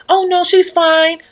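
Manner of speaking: high, loud, slow airy reassurance; breezy dismissal. Retold or imitated. Owner waving off the vlogger's worry, high airy dismissal. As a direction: brush it off lightly in a high voice.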